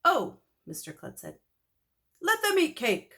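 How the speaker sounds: high, loud, haughty king voice; hammed-up king of France; pompous royal.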